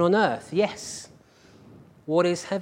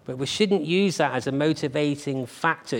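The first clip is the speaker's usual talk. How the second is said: ordinary talk, nothing marked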